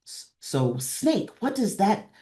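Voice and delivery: ordinary delivery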